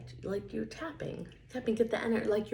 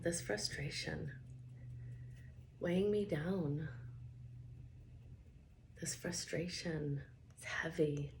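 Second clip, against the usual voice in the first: quieter, heavier statements with sighing ughs; soft tapping script; 6 dB quieter than usual. Acted. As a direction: let the words sag under the weight.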